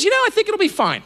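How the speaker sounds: light, quick, high-pitched reassurance; breezy wife. Retold or imitated. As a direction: quote your wife brushing off the worry. Quotes wife's breezy answer.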